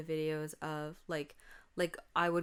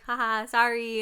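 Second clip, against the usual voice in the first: cheeky sister; 6 semitones higher, 9 dB louder than usual. Retold or imitated. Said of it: "she was like".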